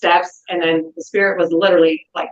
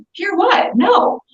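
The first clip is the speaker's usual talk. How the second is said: alarmed shout; 12 semitones higher, much slower than usual. Retold or imitated. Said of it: "I said".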